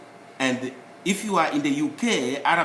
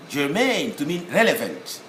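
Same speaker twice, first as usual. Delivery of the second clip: normal talking voice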